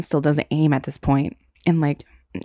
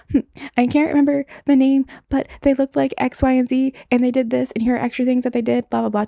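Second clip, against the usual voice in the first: higher, louder rush of a bluffed answer; bluffing answer; 7 semitones higher, 3 dB louder than usual. Retold or imitated. Student bluffing exam answer to professor, higher and louder. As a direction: talk it up like you know it.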